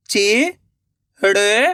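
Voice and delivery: शिकायत stretched out slowly, sound by sound, rising at the end; slow syllable by syllable. Shown as a demonstration. Reading teacher sounding out a word for children, slowly syllable by syllable. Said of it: "मेरे तरीके पे गौर करना है".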